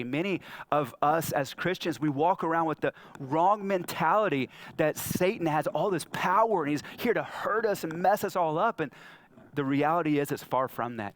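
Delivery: usual speaking voice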